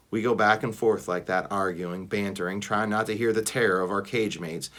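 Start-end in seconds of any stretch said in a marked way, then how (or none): none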